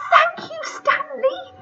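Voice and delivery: high, slow happy thanks; smiling thanks. Acted. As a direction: thank him with a smile. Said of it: "said Sydney and Susie, smiling".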